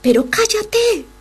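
high, slow, laughing mock scold; annoyed hush. Apostles scolding the shouting man, laughing mock scold. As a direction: shush him, half laughing. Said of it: "le decían".

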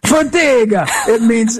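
high, theatrical surname call; showy name announcement. Acted. Guest reveals his surname to the host, playfully theatrical.

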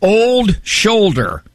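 high, drawn-out mock diagnosis; slow, high punchline in the surgeon's voice; amused surgeon. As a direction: imitate an amused surgeon, stretching it out. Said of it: "he looked at me and he laughed".

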